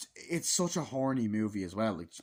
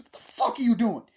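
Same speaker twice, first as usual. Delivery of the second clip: higher, louder outraged shout; angry yell; 10 semitones higher, 10 dB louder than usual. Retold or imitated. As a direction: bark it at the intruder. Character yelling at Michael.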